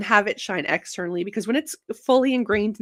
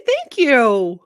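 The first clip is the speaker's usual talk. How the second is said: louder, bright "Thank you" with the stress on "Thank", falling; excited thanks; 8 dB louder than usual. Real, not acted. Host thanking chat viewers, excited. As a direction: burst out with gratitude.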